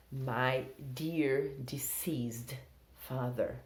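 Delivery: very slow, soft, every word apart; slow model sentence. Shown as a demonstration. Teacher reading an example sentence very slowly. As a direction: lay out the sentence slowly for learners. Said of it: "model sentence slowly".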